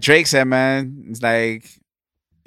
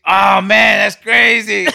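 excited shout; 6 semitones higher, 10 dB louder, slower than usual. Retold or imitated. Drunk friend quoted, shouting.

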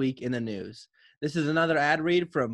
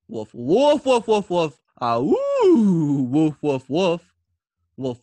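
loud, eager barking woofs; dog barking excitedly; 6 dB louder, slower than usual. Acted. Dog reads the ad, barking loud and eager. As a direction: bark loudly like an excited dog. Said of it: "reading it from the perspective of Duke Bush".

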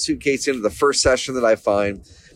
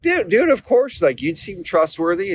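much higher, enthusiastic "dude, dude"; eager reassurance; 7 semitones higher than usual. Retold or imitated. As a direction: insist happily, with the pitch leaping up. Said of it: "He's like".

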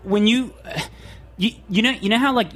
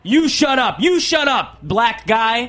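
higher, louder, barking cop; mock cop shout; 6 semitones higher, 4 dB louder, much slower than usual. Host mimics cop shouting down the driver, loud bark.

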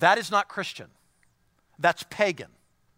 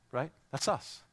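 normal talking voice

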